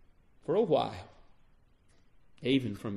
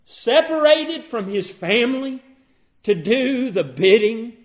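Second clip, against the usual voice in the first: loud, high, emphatic preaching; preaching at full force; 5 semitones higher, 10 dB louder than usual. Real, not acted. Preacher to congregation on Christ obeying God, preaching at full force. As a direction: proclaim it at full force, voice raised.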